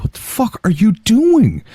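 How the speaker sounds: high mock disbelief; incredulous. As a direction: imitate a stunned teen reacting. Retold shocked look at father.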